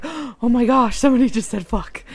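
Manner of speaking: loud scandalized gasping voice; mock shocked gasp. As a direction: play it pearl-clutching shocked. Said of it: "they're not gonna be like".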